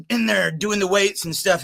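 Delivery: ordinary talk, nothing marked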